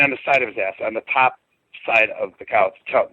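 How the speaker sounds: ordinary delivery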